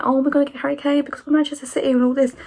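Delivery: high, fast, mocking voice of an arrogant owner; smug club owner. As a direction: mimic a cocky owner sure of the deal. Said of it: "been like".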